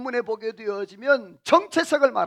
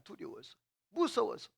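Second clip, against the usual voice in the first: breathy whisper of the word 두려워서, no voice at all; 13 dB quieter than usual. Shown as a demonstration. Preacher to congregation, 두려워서 barely whispered. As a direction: whisper 두려워서 like a frightened hider.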